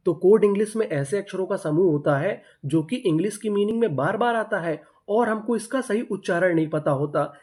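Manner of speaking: usual speaking voice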